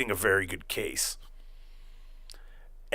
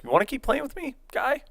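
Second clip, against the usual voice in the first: mock taunt; 13 semitones higher than usual. Taunting Ray in retold quarrel.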